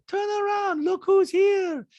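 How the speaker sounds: put-on very high-pitched accented caricature; squeaky falsetto mimicry of a reality star; Diana impression. Host mimics reality star in recap, high-pitched caricature. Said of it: "And Diana goes".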